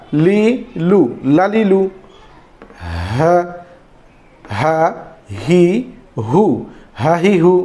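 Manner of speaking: normal talking voice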